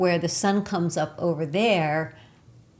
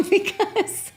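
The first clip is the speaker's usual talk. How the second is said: laughing through the words, higher and louder; 4 dB louder than usual. Real, not acted. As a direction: break into real laughter while saying it. Laughing at the basics.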